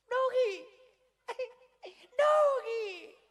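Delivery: very high, loud squeal; city woman cooing over a dog. Retold or imitated. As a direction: squeal at the puppies. Tourist squealing at puppy.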